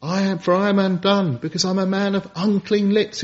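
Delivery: Isaiah crying out. Retold or imitated. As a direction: cry out like the prophet in anguish. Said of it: "Isaiah cried out".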